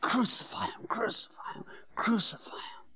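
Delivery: hushed, breathy echo of a jeering mob; whispered crowd cry. Retold or imitated. Preacher recalls the crowd, whispered chant. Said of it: "outcries from the crowd".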